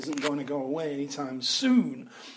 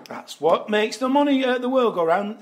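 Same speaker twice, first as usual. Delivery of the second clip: a loud, higher-pitched mock Jim Davidson; Jim Davidson impression; 8 semitones higher, 9 dB louder than usual. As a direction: belt it out like a music-hall comic. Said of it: "Bit of Jim Davidson".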